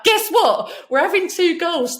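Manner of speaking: high, loud, bursting with news; excited announcement. Retold or imitated. Telling the dad she's having two girls, excited and loud.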